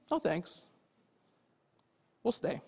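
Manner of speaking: softly, casually declining; understated refusal. Acted. Prisoners answering the magistrates who try to release them, quiet understated refusal. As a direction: turn it down quietly and simply.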